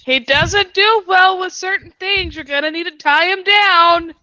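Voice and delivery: high, loud warning call. Acted. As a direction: call out across the room. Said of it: "Vitur calls out to her father".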